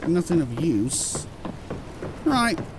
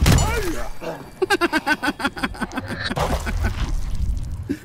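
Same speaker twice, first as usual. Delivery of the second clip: high, drawn-out laughing fit; long helpless laugh; 6 semitones higher than usual. Real, not acted. Gamer cracking up at his brass-knuckle fight, long helpless laugh.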